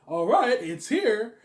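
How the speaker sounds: hammed-up Robert, shrill and loud; Robert impression. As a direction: announce the arrival like a hyped streamer. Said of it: "Not a bad impression of Robert".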